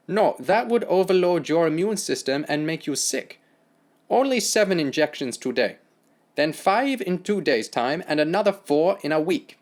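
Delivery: German doctor explaining; German accent. Acted.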